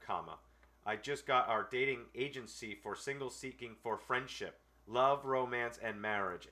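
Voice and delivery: dry dramatic reading; reading a letter aloud. Acted. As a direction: read it word for word, pauses and all. Reads a scam letter aloud.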